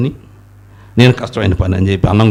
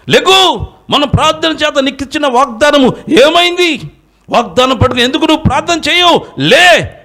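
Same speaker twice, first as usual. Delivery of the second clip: fiery preaching. Acted. Preacher rebuking the congregation for weak faith, fiery preaching. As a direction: preach it hard and rising to the crowd.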